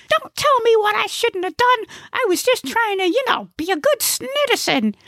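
very high, put-out comic voice; indignant Gnesi. Acted. Gnesi defends himself to the old lady, high and indignant. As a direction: protest in a high-pitched character voice.

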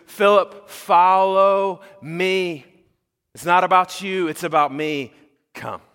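Jesus summoning his disciple, loud and slow; Jesus calling out. Acted. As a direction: call him to follow you with authority. Jesus calling Philip, acted.

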